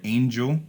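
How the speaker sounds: "angel" with the first syllable stressed. Shown as a demonstration. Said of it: "the first syllable is stress".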